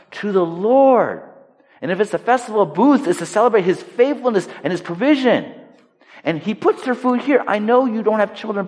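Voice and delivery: high, sustained fervent preaching; impassioned preaching. Acted. Preacher telling of a husband's double portion for his wife, impassioned. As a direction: proclaim it with mounting fervor.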